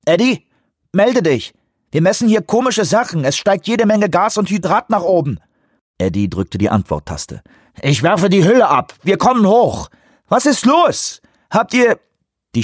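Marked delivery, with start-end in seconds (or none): 0.0-5.4 tinny voice over the radio. Acted. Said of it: "Die blecherne Stimme des Technikers". 7.8-12.0 high, loud urgent calls; urgent shouting. Acted. Pilot shouting over radio, sub crisis.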